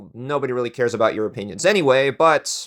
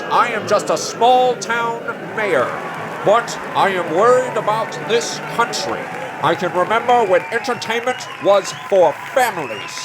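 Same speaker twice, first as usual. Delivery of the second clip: rabble-rousing small-town mayor; 5 semitones higher than usual. Acted. Small-town mayor rallying his voters against comics, fiery stump speech. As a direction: whip up the crowd like a fearmongering politician.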